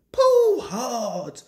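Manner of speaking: high, falling heaves of effort; puffing. A narrator's reading. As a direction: heave each phrase out like a straining engine. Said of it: "puffed Edward".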